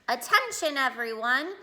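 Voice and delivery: P A announcement. A narrator's reading. Principal to the school over the P A, announcing. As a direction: call the whole school to attention. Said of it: "clicked on the P A system".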